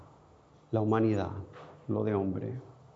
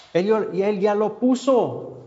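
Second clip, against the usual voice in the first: ordinary talk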